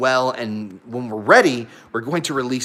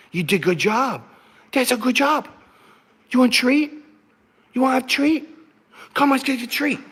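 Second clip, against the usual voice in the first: much higher sweet praise for the dog; high pet voice; 11 semitones higher than usual. Real, not acted. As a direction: coo at her like a puppy. Praising the dog, offering treat.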